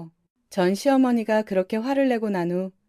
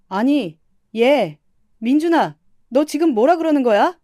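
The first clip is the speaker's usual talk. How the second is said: loud, higher shouting at her son; angry yell; 5 semitones higher, 7 dB louder than usual. Acted. 시어머니가 아들 민준에게 화가 나 높은 목소리로 소리침. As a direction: yell his name in furious disbelief. Mother-in-law yells at son.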